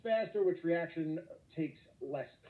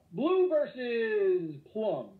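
slow dramatic; 6 semitones higher, 4 dB louder, much slower than usual. Real, not acted. Teacher setting up comparison.

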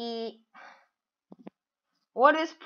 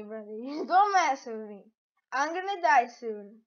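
ordinary talk, nothing marked

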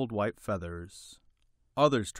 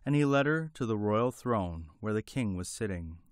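ordinary delivery

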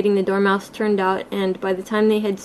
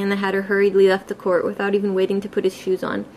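ordinary delivery

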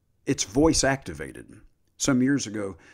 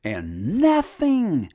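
drawn-out emphasis; 6 semitones higher, 5 dB louder, much slower than usual. Acted. Preacher stressing scripture slowly.

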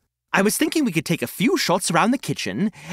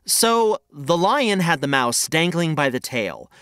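ordinary delivery

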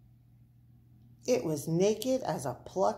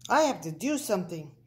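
ordinary delivery, nothing marked

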